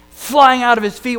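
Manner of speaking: ordinary delivery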